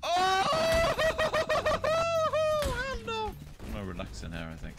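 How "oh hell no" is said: high, loud laugh breaking into a yell; panicked laughing shout. Real, not acted. Player cracks up after the scare, shrieking with laughter. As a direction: laugh off the fright at full volume.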